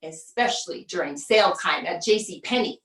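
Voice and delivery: normal talking voice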